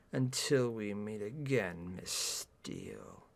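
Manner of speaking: slow, very low, hushed farewell; quietly menacing suave man; veiled threat. A narrator's reading. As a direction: sink to a deep murmur on the name. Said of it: "sounds like a challenge or a threat".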